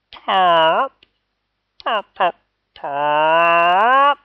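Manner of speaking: loud, high run of pops; silly fart pops from the bully's chair; comic popping noises. A narrator's reading. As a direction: pop pop pop like a cartoon. Noise from under Lee.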